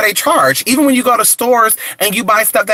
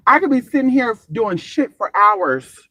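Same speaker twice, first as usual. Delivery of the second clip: normal talking voice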